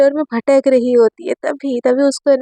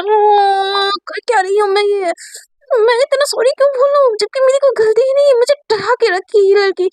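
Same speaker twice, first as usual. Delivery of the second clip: much higher, near-crying frustration; tearful outburst; 8 semitones higher than usual. Acted. Sakshi alone, tearful and frustrated, much higher. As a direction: let it crack into tears of anger.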